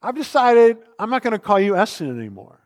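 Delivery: put-on high, loud, cocky kid voice, rushed; a bratty sixth grader announcing it; mock punk kid. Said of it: "some punk sixth grader just came up to me and said".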